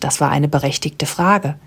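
ordinary delivery

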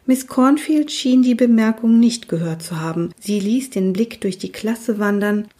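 usual speaking voice

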